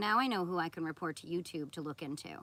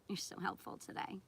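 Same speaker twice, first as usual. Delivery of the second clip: whispered mock praise; sarcastic whisper; 7 dB quieter than usual. Real, not acted. Streamer mocking the chatter's help, whispered and sarcastic. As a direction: breathe it out, dry and sarcastic.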